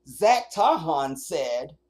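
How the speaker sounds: slow, drawn-out loud mock of the article's "Zach Tahan said"; mocking quote. Streamer mocking reporters' casual first name, slow sneer. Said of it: "but no, no".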